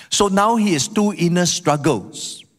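ordinary talk, nothing marked

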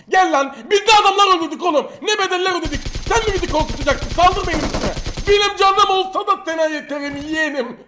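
high, loud, fast fighting taunt; taunting shout. Real, not acted. Streamer daring an enemy player to attack, loud taunting shout. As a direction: dare him to come at you.

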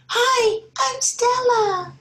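put-on very high, slow small-girl voice; little girl greeting. Teacher voicing Stella for her class, very high. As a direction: say hi like a tiny girl meeting someone new. New girl introduces herself.

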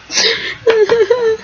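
short high-pitched giggle; laughing at the odd sentence. Real, not acted. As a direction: giggle at yourself. Laughing at own remark.

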